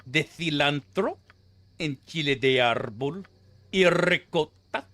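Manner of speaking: put-on overdone Spanish accent with trilled r's; ridiculous Spanish accent. Streamer mocking pretentious cooks again, exaggerated Spanish accent. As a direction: draw out each ingredient in a mock Spanish accent. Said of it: "attaching the most ridiculous accent to it ever".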